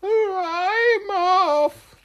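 very high, loud squeal; panicked squeaky little creature; frightened spider. Acted. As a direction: shriek it as a fleeing spider. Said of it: "which frightened Spider".

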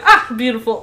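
loud, laughing imitation of admiration; delighted mock praise. Host quoting the admirer of the name, mock delight. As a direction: gush over it, breaking into laughter. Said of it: "He's like".

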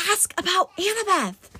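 high, urgent mock scream; inner scream. A narrator's reading. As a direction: cry it out high without much added volume. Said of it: "The voice inside me was screaming".

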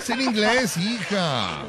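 put-on high, drawn-out gringo-accented Spanish; mock English. Host reminding his pupil, mock English accent. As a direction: keep up the fake English accent.

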